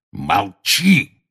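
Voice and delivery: gruff, curt bark; barked order. A narrator's reading. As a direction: bark it to silence him. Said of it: "рявкнул Грюм".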